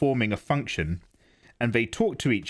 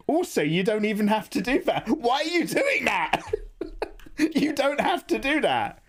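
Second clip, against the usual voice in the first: laughing mock scolding; 10 semitones higher than usual. Streamer laughing at a film scene, high mock scolding. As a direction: tease the film through laughter.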